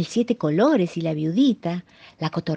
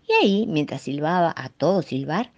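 plain reading voice

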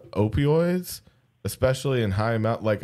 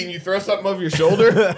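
ordinary talk

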